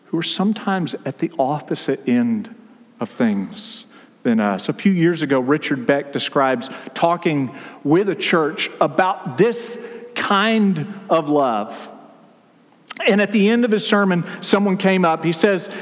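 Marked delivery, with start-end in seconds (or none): none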